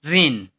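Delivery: रेन said as one falling syllable, like English "rain"; pronunciation demo. Shown as a demonstration. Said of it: "pronunciation क्या होगा?". (रेन का स्पष्ट उच्चारण, "rain" जैसा)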